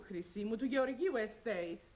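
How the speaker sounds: slowly weighting "Γεωργίου Estate"; "Γεωργίου Estate" drawn out syllable by syllable; stressing the name. Acted. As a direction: spell out "Γεωργίου" for the clerk.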